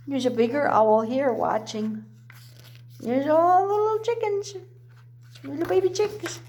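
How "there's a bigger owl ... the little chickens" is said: usual speaking voice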